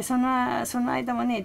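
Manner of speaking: ordinary delivery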